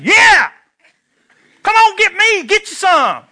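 little brother gloating after his brother beat the bullies; triumphant whoop. Retold or imitated. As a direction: crow it like a kid who just won. Boy gloating after the fight.

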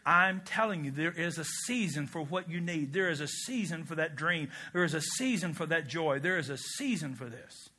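steady, hushed promise, each phrase set down patiently; God speaking. Acted. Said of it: "God says".

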